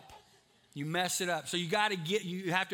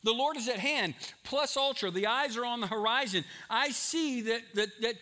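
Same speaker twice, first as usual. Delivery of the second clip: raised, urgent pulpit voice, building louder; fervent preaching; 6 semitones higher, 4 dB louder than usual. Real, not acted. Preacher tells church the Lord's return is near, fervent preaching. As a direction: drive it home higher and harder, phrase by phrase.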